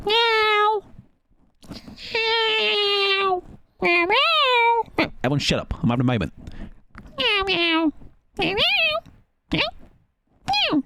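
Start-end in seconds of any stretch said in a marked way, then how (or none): 0.1-5.1 very high, loud cat meows. Pretend. Meowing to the cat. 7.2-10.8 high-pitched imitation cat meows. Talking to Salem's cat in meows.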